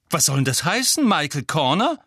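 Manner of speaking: lauter, höher, empörter Ausruf; Empörung herausrufen. A narrator's reading. Ron empört über Schwester. (empört und laut)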